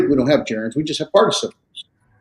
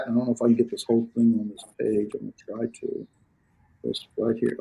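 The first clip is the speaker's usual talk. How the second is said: talking to oneself; 2 semitones lower, 10 dB quieter, faster than usual. Real, not acted. Teacher trying to fit the page, murmuring to self. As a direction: murmur it while busy with the page.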